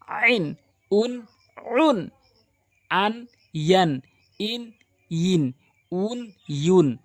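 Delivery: আন ইন উন stretched slow from the throat; Quranic letter drill. Shown as a demonstration. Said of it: "এটি হচ্ছে আন".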